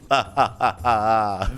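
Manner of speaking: staccato mock cackle; put-on sinister ha-ha-ha; evil villain laugh. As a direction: laugh like a pretend kidnapper. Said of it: "A little too into character here".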